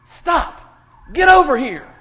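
loud, high shout of a wife in a dream; retold shout. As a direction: yell it like a sudden command from sleep. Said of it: "shouted out".

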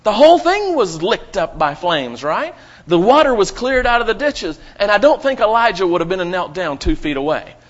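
loud, rising preacher's build; preaching fervor. Real, not acted.

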